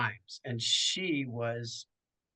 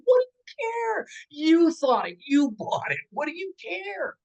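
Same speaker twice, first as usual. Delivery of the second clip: much higher and louder, incredulous yelling; exasperated outburst; 5 dB louder than usual. Retold or imitated. Snapping back at the gloating director, high and loud. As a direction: fling it back at her in disbelief. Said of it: "And I'm like".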